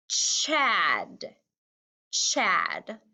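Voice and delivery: "Chad" stretched, said as a whole; blended word. Shown as a demonstration. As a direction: join the sounds into a drawn-out "Chad". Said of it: "One more time".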